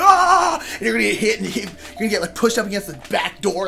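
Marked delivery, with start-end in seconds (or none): 0.0-0.6 sudden loud high shriek; hammed-up cry of panic; a pretend panicked shriek, loud and high; mock scream. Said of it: "We're like".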